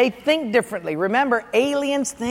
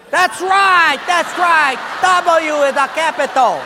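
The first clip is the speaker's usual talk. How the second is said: higher, loud "that's right"; loud approval; 4 semitones higher, 4 dB louder than usual. Acted. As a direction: cheer on the winner. Personnel guy approves Portuguese.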